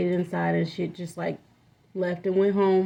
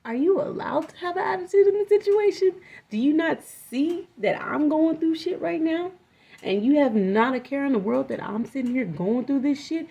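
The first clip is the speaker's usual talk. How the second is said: incredulous anger; 7 semitones higher than usual. Retold or imitated. Retold confronting friend about attitude.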